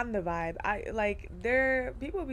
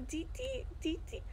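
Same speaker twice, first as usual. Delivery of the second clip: indicator tick imitation; 8 semitones higher, 6 dB quieter than usual. Imitating the turn indicator ticking.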